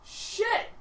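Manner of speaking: high sudden yelp; startled yelp. Acted. Ogre yelping in surprise as he looks up. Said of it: "and he goes".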